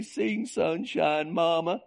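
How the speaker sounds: put-on high, drawn-out pleading child voice; little kid imitation. Mother mimics her small son begging for a lullaby, high kid voice. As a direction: whine it slow and high like a little Okie kid. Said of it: "she'd love to imitate me as a little kid".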